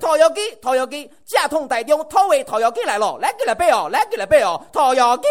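Taiwanese hawker's cry. Retold or imitated. Imitating a Taiwan roast chicken seller, loud and high. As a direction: sell it like a movie trailer voiceover. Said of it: "这整个说出来是？".

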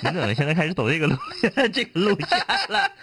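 laughing high and fast. Real, not acted. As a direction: laugh through the teasing question.

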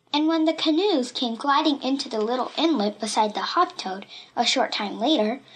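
plain reading voice

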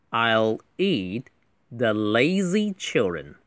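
slow, careful textbook reading with space between words; slow model reading. Shown as a demonstration. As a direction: read it slowly and distinctly for learners. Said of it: "我中等语速读一次". (慢速领读)

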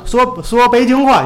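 high, slow mock Beijing accent. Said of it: "说普通话不像你说那个".

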